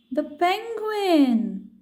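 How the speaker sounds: slow storyteller reveal; dramatic reveal. A narrator's reading. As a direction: stretch the words out for the big reveal. Climax reveal of the penguin.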